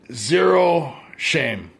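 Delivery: drawn-out, deliberate delivery; slow emphatic repeat. Real, not acted. Vlogger hammering home having no shame, slowly and deliberately. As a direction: give each word its own space.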